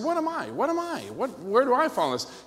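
high-pitched, mock-eager questions; eager questioning. Pastor asking friend for his personality type, imitated eagerness. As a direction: pester him like an excited kid.

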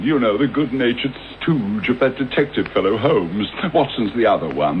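ordinary talk, nothing marked

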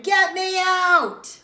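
high, loud, long-drawn yell; desperate shout. Acted. Wriggling child demands out while shopping, shouting. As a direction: holler it slowly, stretching the last word. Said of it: "in the end I shouted".

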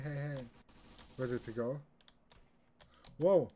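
ordinary delivery

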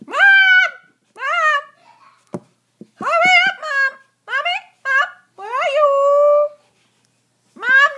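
loud whiny demanding calls; yelling across the room. Pretend. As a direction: holler for mom from another room. Yelling for mom.

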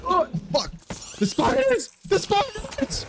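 high panicked screaming; screaming at a spider. Real, not acted. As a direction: shriek at the spiders. Panicked gamer attacked by spiders.

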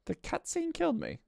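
high but soft, deflated complaint; subdued disbelief. Real, not acted. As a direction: say it small and high after the outburst. Repeats complaint after long pause.

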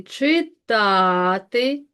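читати very slow, with a long ТА; stress on second syllable. Shown as a demonstration. Teacher marking unstressed vowels for pupils, stressed syllable stretched. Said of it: "і ненаголошені".

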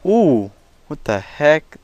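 sudden startled yelp. Real, not acted. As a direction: jump at the scare. Jump scare in horror game.

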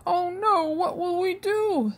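hungry family in alarm; cried out. A narrator's reading. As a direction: brothers fear hunger. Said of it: "they cried".

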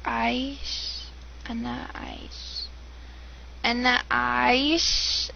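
slow, careful "Aish" with the throat sound attempted; drawing out "Anna Aish" syllable by syllable; struggling pronunciation. Shown as a demonstration. American girl trying to say Arabic "Aish", slowly. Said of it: "I can't say it".

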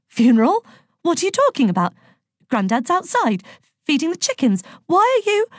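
high, agitated confusion building to alarm; rising panic. A narrator's reading. Confused mum insists Grandad's outside, rising panic. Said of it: "her voice trembling as she pulled away".

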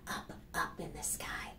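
softly whispered. Acted. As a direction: let it drift away in a whisper. Reading bird book to kids.

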